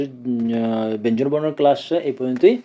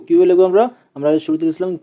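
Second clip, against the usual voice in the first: ordinary delivery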